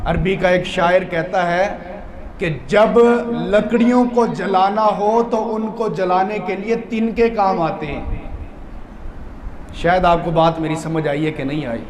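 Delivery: usual speaking voice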